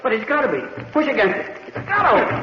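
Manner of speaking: high, strained cry of panic; panicked plea. Acted. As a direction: beg the door to give way. Trapped in sealed vault.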